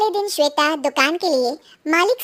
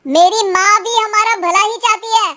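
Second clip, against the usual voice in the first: a touch of anger, insistent; mildly raised voice; 5 semitones higher, 4 dB louder than usual. Acted. Husband defends his mother to his wife, mildly angry. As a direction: push back in mild irritation. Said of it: "अरे पर चिल्ला क्यों रहे हो".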